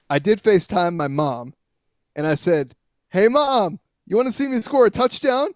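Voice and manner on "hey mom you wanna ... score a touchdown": excited call to mom. Retold or imitated. FaceTime call to mom from field.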